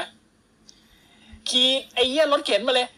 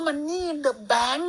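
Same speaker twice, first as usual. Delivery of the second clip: slow, stretched and a touch higher, rising at the end; dreamy drawn-out echo; 4 semitones higher, much slower than usual. Retold or imitated. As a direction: savour each word like a dream title. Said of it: "อ๋อ".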